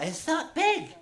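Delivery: high, lightly voiced old Cornish woman; old woman's friend. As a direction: ask it up high like a granny. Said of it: "Her mate said".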